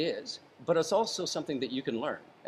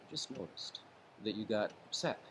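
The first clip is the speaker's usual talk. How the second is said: much softer, gentle and calming; 3 semitones lower, 8 dB quieter than usual. Shown as a demonstration. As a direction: lower your voice to settle him down. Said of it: "you might say".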